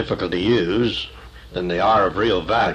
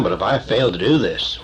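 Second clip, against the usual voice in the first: ordinary delivery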